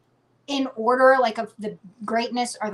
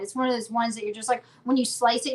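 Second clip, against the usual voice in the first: ordinary talk, nothing marked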